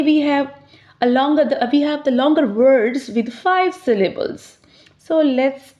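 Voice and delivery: ordinary talk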